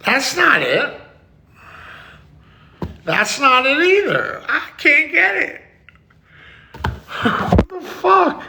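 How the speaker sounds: very high, frustrated outburst; exasperated. Pretend. Streamer who can't get it back, exasperated. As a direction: complain way up high as each try fails. Attempts keep failing.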